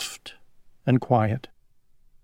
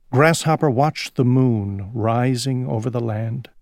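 ordinary delivery, nothing marked

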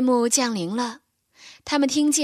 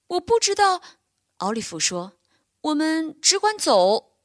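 fawn voice; 7 semitones higher than usual. A narrator's reading. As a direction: say it simply, without worry.

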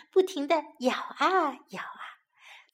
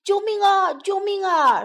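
loud, high desperate roar for help; trapped lion roaring for help; 7 semitones higher, 7 dB louder, slower than usual. A narrator's reading. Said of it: "狮子吼叫道".

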